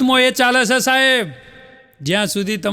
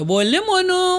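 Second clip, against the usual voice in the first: much higher, laughing mock sweet voice; husband replying to wife, much higher giggly voice; sugary reply; 10 semitones higher than usual. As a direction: answer like a lovestruck husband. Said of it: "પેલો એ હામો હારુકલો હોય".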